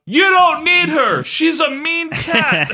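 high, drawn-out heckle at normal volume; slow, high-pitched mock holler; mock heckler yell. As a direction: imitate a guy yelling from the crowd. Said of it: "Some guy yells out".

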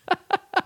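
short high-pitched giggle; high squeaky laugh. Real, not acted.

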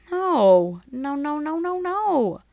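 loud no climbing into high repeated noes; appalled refusal. Acted. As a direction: refuse it in mounting mock horror. Appalled rejection of the mispronunciation.

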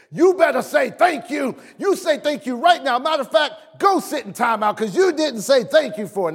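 high-pitched mock telling-off; wife's scolding. Wife to ungrateful child at dinner, imitated scolding. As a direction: imitate a mother laying down the law. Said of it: "my wife gets all over it".

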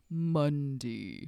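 flat, low monotone. Shown as a demonstration. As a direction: drone it with no tune. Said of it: "like, a monotone voice".

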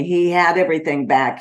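ordinary talk

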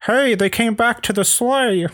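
very high-pitched cartoon voice; excited little character. Spotting the reindeer back at the sleigh, excited. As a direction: squeak it out in a high put-on voice.

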